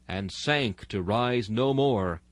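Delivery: normal talking voice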